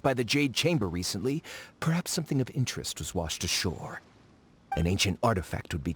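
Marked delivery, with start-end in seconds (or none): none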